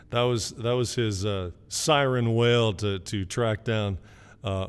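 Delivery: ordinary talk, nothing marked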